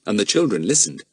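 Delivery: plain reading voice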